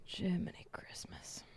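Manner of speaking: whispered mock exclamation; soft whispered aside. Real, not acted. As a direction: breathe it out under the voice. Muttered exclamation.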